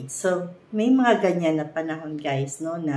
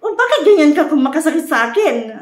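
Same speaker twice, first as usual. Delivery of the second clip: mock-angry, louder, higher and fast; angry accusation; 8 semitones higher, 9 dB louder, faster than usual. Partner snapping back at the one who upset them, mock anger. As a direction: hurl it back as an accusation, half acted. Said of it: "Nagagalit ka na ngayon".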